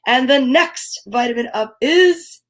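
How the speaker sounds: ordinary talk, nothing marked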